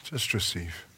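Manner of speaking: very soft, hushed and unhurried; soft prayerful murmur. Real, not acted. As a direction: murmur it gently as the congregation receives prayer. Prayer ministry moment, soft invitation.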